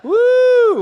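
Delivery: a high, loud whoop. Real, not acted. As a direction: yelp to fire up the room. Comic hypes crowd on arrival.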